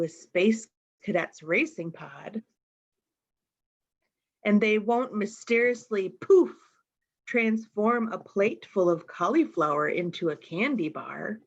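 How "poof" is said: high, loud poof; magic poof. A narrator's reading. Storyteller making magic burst out, high and loud. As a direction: pop it out like a magic trick.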